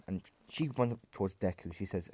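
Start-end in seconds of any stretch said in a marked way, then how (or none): none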